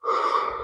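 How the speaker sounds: long soft exhale; unhurried calming sigh as a demo; demonstrated breath. Shown as a demonstration. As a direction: breathe out slowly and quietly. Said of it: "that didn't take very long".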